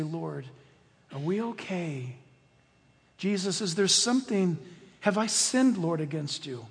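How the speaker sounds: soft anxious plea to God; hushed prayer. Retold or imitated. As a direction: pray it quietly on your knees. Believer praying on their knees.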